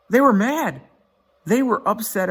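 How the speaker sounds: rising up high and loud; really worked up; animated. Real, not acted. Outraged fans.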